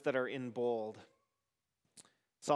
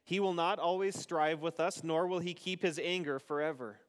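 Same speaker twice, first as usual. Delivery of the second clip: responsive psalm reading. Acted. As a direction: lead the psalm and leave room for the congregation.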